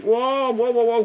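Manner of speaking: hammed-up fright; scared backing off. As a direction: back away from the guns. Golfers see the bag of guns.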